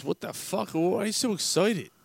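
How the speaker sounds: high-pitched, puzzled joke husband; baffled husband. Acted. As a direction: act out mild bewilderment, voice lifted. Joke husband baffled by bouncing wife.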